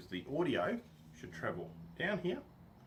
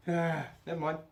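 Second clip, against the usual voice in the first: ordinary delivery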